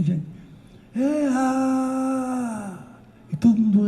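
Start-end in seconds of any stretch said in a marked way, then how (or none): 1.0-2.8 loud call. Retold or imitated. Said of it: "gritava bem alto assim".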